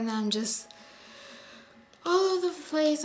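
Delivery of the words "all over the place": hectic. Real, not acted. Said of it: "I'm just hectic right now".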